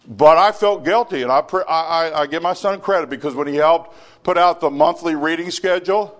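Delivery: loud, high preaching; sermon at full force; fiery preaching. Acted. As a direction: press the congregation hard.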